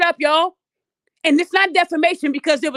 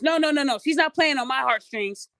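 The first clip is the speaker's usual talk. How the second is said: ordinary delivery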